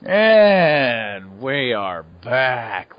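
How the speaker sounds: very slow, stretched show return; drawn-out welcome back. Pretend. Host returning from the break, drawn-out announcement. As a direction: stretch every word of the return.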